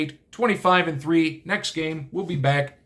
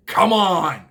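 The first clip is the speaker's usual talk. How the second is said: exasperated come on; 3 semitones higher, 5 dB louder than usual. Real, not acted. Mocking a cute animal trophy.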